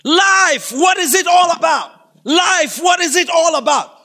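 high, loud declaiming shout; theatrical scream from the crowd; screamed skit line. Acted. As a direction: cry it out dramatically, one burst after another. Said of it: "another person screams".

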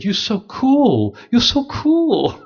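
high, slow, drawn-out gushing; mock awed admirer. Guide marvels at the priest, slow high gushing. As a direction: ham up the guide's awe, stretching each word.

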